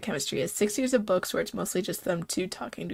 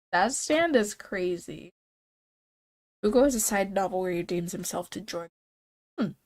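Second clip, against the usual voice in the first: ordinary talk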